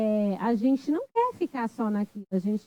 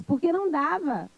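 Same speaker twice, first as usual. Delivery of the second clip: ordinary delivery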